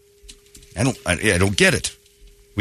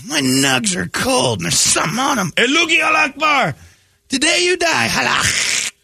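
very high shouting customer voice; furious customer; 12 semitones higher than usual. Acted. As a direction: yell the complaint up high. Customer then worker voiced.

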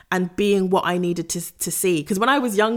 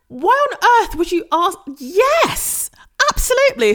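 high, loud, gushing yes; excited squeal; 13 semitones higher, 5 dB louder than usual. Retold or imitated. Friend offers [name] tickets, she replies in a high excited squeal. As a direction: squeal with disbelief at the question. Retells her eager reply to invite.

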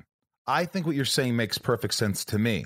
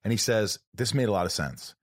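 ordinary delivery

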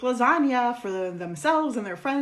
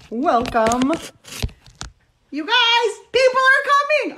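excited; 13 semitones higher, 6 dB louder than usual. Real, not acted. Host greets party with excitement.

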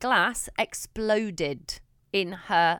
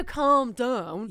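mocking calm down; 3 semitones higher than usual. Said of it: "Don't go".

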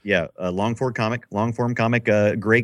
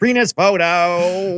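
mock drawl; 11 semitones higher, 6 dB louder, much slower than usual. Joking echo, high slow.

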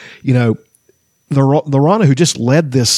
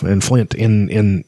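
ordinary talk

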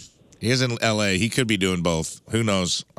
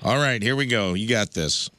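usual speaking voice

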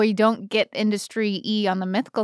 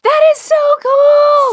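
much higher and louder, thrilled; excited; 9 dB louder, slower than usual. Real, not acted. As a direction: gush with real delight. Excited reaction to big news.

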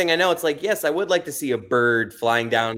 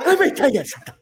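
much higher zany character voice; Fire Marshal Bill; 12 semitones higher, faster than usual. Retold or imitated. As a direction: squeeze it up high like the cartoonish marshal. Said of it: "You sound like Fire Mar".

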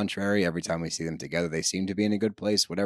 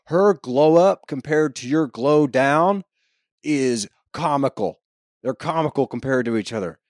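raised, emphatic mock put-down; retold insult; 6 semitones higher, 7 dB louder than usual. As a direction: deliver the quoted dig slowly, loud and high. Acting out a quoted insult.

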